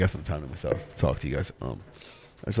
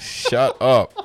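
loud, laughing shout; laughing protest; 5 semitones higher, 9 dB louder than usual. Real, not acted. Buddy cracking up at the accent teasing, loud laughing protest. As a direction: tell him off while cracking up.

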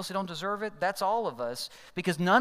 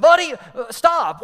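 much louder, higher, furious yell; angry shout; 10 semitones higher, 13 dB louder than usual. Retold or imitated. Angry driver chasing Dan to the car, yelling. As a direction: bellow after him across the lot. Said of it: "the guy was mad".

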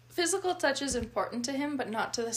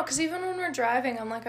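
ordinary talk, nothing marked

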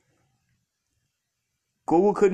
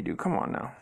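ordinary delivery

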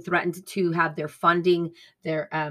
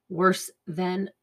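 ordinary delivery

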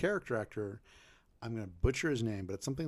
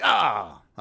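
loud, laughing Italian pilot; belly-laugh it out like the pilot; hearty mock pilot laugh; 13 dB louder than usual. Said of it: "the pilot's laughing".

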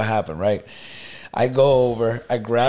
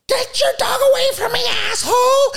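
very high, shrieking mock woman; imitation of a shrill, screaming lady; screamed insults. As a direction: screech it like an irate stranger, hammed-up. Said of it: "like, really loud".

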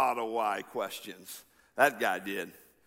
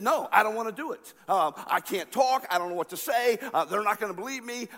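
higher, pleading, getting louder to a shout; reluctant Moses; 7 semitones higher, 3 dB louder than usual. Retold or imitated. Moses refuses God's call, pleading then shouting. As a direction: beg God to pick someone else, building to a protest. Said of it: "he said".

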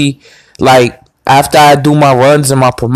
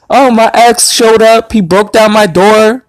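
higher put-on voice of a distressed girl; imitated upset woman; 8 semitones higher than usual. Girl explaining her ex's attack, mimicked distress. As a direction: lightly mimic her telling her story. Retelling the upset girl's account.

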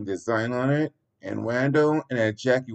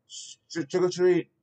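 nervous; 8 semitones higher than usual. A narrator's reading. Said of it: "nervously said".

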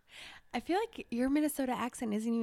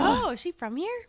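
higher, surprised inner question; surprised thought; 7 semitones higher than usual. Retold or imitated. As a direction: wonder if she is local. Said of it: "I was like".

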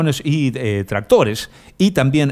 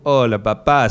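usual speaking voice

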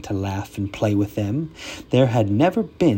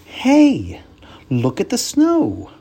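put-on high, loud child voice; excited children; 9 semitones higher, 5 dB louder, slower than usual. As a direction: cry out like thrilled kids. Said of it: "cried the children".

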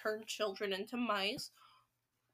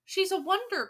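higher, louder admiring cry; 7 semitones higher, 5 dB louder than usual. Acted. Witches cheering their leader in admiration. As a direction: gush over her, mildly. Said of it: "cried the audience".

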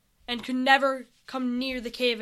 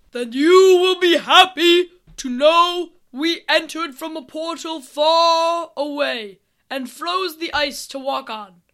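loud, high, stretched-out proclamation; army leader thundering from afar; booming voice; 4 semitones higher, 11 dB louder, slower than usual. Acted. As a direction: boom slowly across the ice. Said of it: "the voice boomed again".

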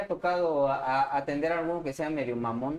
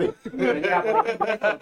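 laughing; 7 semitones higher, 7 dB louder than usual. Real, not acted. Laughing mid-story.

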